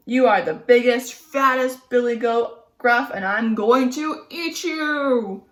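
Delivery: loud troll thunder; troll refusing the biggest goat, thundering. Acted. Troll threatens.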